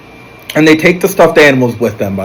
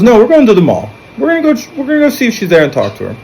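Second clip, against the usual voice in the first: insistent; 9 semitones higher, much faster than usual. Retold or imitated. Benson insists on the mall.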